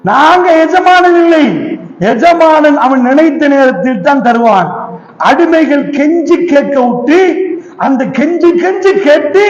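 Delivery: usual speaking voice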